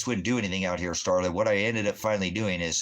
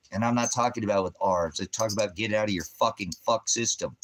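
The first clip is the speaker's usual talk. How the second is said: usual speaking voice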